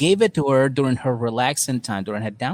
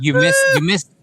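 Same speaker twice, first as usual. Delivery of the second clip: louder, higher insistence; emphatic correction; 5 semitones higher, 5 dB louder than usual. Real, not acted. Cohost interrupts to correct, louder.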